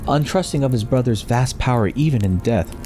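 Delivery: usual speaking voice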